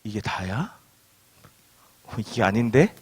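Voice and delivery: low, hushed puzzled questioning; underwhelmed doubt. Acted. Reader finishing the list of blessings, quietly underwhelmed. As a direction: drop right down, as if privately let down. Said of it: "라는 생각이 들 수 있다는".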